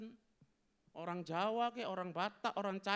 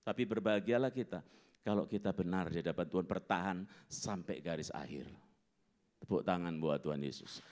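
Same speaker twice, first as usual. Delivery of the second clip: gentle encouragement; 7 semitones lower, 3 dB louder than usual. Acted. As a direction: ease the crowd toward hope. Softer comforting preaching.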